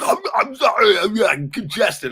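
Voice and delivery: high, loud, whimpering mock of a sick man; sick lawyer imitation. Mocking the congested lawyer to his viewers, high teary whimper. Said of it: "about ready to cough out a lung".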